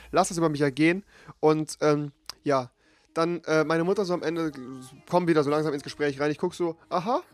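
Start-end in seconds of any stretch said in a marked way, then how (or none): none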